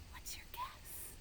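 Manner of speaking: high breathy whisper. Acted. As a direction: whisper the question softly. Whispered question to kids.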